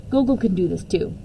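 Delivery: usual speaking voice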